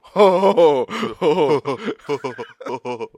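loud, boomy ho ho laugh; deep manly laugh. Pretend. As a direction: laugh like a big burly man. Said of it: "So I can go".

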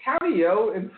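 very high-pitched exasperation; real high-pitched ranting; exasperated rant. Real, not acted. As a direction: tell them off with the voice pushed right up. Exasperated rant telling company off.

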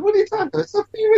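very high, incredulous squeal; mock indignation. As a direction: act outraged, disbelieving. Mock outrage at Jerry.

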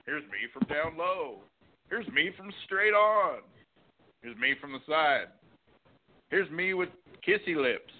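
high, bubbly selfie-poser voice. Retold or imitated. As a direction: play a vain selfie taker, up high.

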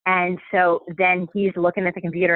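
usual speaking voice

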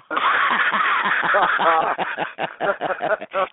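real laughing fit; long high laugh. Real, not acted. Cracks up after punchline.

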